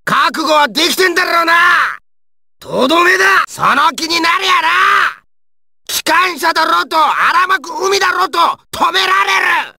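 high-pitched, aggressive shouting; battle shout. Acted. Fighter going in to finish his enemy, fierce shout. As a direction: bellow it in triumphant aggression.

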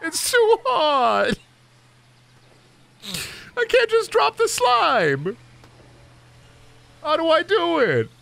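very high, drawn-out fake wail; hammed-up high-pitched pleading; mock whiny despair. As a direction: whine it like it's agony. Mock despair over dropping slime.

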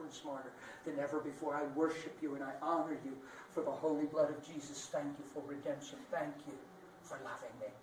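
quiet, soft prayer voice. Shown as a demonstration. Preacher demonstrating a prayer to God, soft. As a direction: pray it softly.

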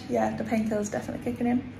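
ordinary delivery

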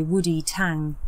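usual speaking voice

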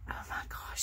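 soft, voiceless gasp of amazement; awed whisper. Real, not acted. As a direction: whisper it, overwhelmed by the gift. Overwhelmed by the gift.

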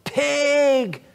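high, loud mock yell out a car window; woman yelling pig from her car; shouted insult. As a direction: shriek it across the road. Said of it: "she shouted out of her window".

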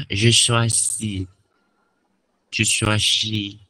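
je choisis dibaca pelan, jelas; slow demo. Shown as a demonstration. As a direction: ucapkan je choisis perlahan dengan jelas. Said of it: "Nah, contoh". (guru mengeja je choisis pelan untuk murid)

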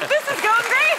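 high, loud, falsely upbeat exclamation; mock-nervous outburst. Rookie telling how she answered the director, high mock-nervous cheer. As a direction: call it out high in comic panic. Nervous retort retold over laughter.

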